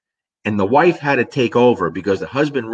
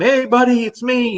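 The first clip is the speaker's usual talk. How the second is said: very high-pitched retold greeting to a dog; high placating call; 14 semitones higher than usual. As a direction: coax the charging dog in a high voice.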